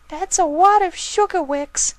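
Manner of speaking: a small filly protesting hard; squeaky filly. Acted. Filly arguing with her brother about the forest, very high and indignant.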